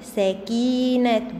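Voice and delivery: "سكينة" slowly, with a long ee after the k; long vowel demo. Shown as a demonstration. Teacher showing the long ya, "سكينة" with drawn-out ee. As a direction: stretch the "كي" in "سكينة". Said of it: "كاف كسرة وياء مد".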